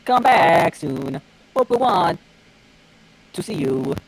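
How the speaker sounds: ordinary talk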